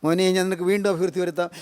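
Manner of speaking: a tender word to a daughter and a son; tender assurance. Acted. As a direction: speak it gently to each listener like your own child. Personal address to daughter, son.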